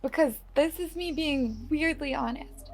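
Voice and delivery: high, quiet and earnest; soft sincere promise. Acted. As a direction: promise it gently like a fairy. Fairy swears an oath softly.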